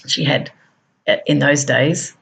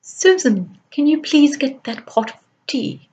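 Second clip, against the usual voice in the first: high, crisp upper-class British English; posh British accent; 10 semitones higher than usual. Retold or imitated. Wealthy British employer asking Susan for tea, in a posh British accent. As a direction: imitate a refined English lady asking politely. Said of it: "I'm just trying to emulate it now".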